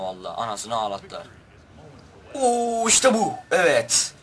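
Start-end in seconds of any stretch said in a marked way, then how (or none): 2.3-4.1 excited. Real, not acted. Excited at game result.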